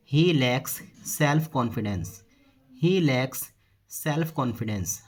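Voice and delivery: slow, word by word; measured dictation-style delivery; slow model sentence. Shown as a demonstration. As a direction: say it slowly for learners, spacing each word. Teacher models the English sentence.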